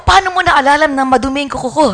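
fast amused question; amused disbelief. Retold or imitated. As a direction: blurt it out in happy surprise. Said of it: "Sabi ko".

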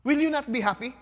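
normal talking voice

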